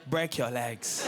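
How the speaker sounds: slow, low "break your legs" with a stubborn fall; mum insisting. Retold or imitated. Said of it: "No, no, no".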